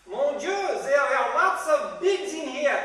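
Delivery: loud, high, slow fake French; mock French accent. French tourist finding the bugs, mock French accent. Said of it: "a French tourist".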